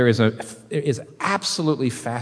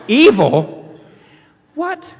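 high, loud startled disbelief; voicing the shocked crowd, much higher; mock shock; 13 semitones higher, 9 dB louder, much slower than usual. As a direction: gasp it like an offended listener.

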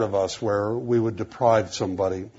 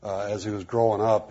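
usual speaking voice

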